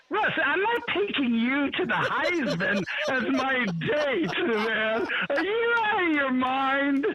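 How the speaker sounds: high, laughing mock of a friend's refusal; laughing retelling of friend. Retelling a friend's joking refusal, laughing high. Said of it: "and he's like".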